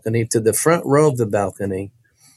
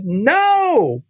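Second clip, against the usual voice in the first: second refusal; 14 semitones higher than usual. Retold or imitated. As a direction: dig in and refuse again. Boy refuses a second time.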